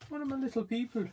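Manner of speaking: very high, silly faked French voice; mock French accent. Vlogger hunting for a little figure, mock French accent. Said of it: "No, stop doing that".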